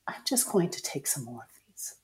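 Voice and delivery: soft whispered inner voice; whispered thought. Retold or imitated. Recalling her thought while taking pills, whispered.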